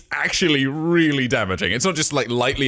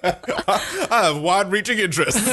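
slow, laughing put-on voice, higher pitch; mock persona voice; 9 semitones higher than usual. Riffing on the fake host in a laughing put-on voice. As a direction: play the persona deadpan through laughter.

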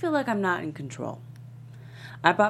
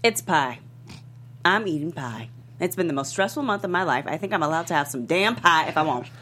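loud, defensive snap over the pie; angry outburst; 5 dB louder than usual. Acted. Oprah to Gail about eating pie, loud defensive outburst. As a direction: blurt it out, fed up and stressed. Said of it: "stunned by the outburst".

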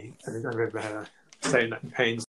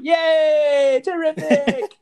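mock delight; 5 dB louder, much slower than usual. Mimics wife's voice returning.

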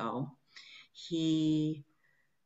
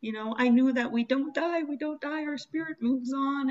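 high, breaking voice, choked with tears; telling Zabu through tears; tearful crying; 8 semitones higher, 3 dB louder than usual. Retold or imitated. To Zabu about her death work, tearful and high. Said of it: "And I'm crying".

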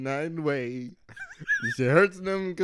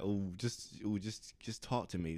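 softer, breathy-sounding ooh, coaxing; mock seductive woman; 6 semitones lower, 14 dB quieter than usual. Imitating a woman on the phone, soft and coaxing. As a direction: lean in and coax softly. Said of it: "She, she'd be like".

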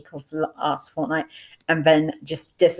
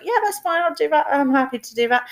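much higher, chirpy mock version of the friend agreeing; imitating best friend; 9 semitones higher than usual. Narrator voicing her best friend's cheerful yes to the cinema. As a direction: play the friend, eager and high-pitched. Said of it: "She's like".

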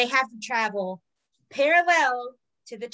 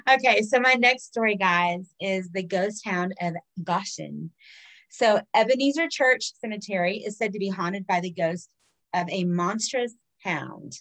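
ordinary talk, nothing marked

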